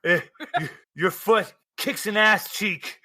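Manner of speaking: ordinary talk, nothing marked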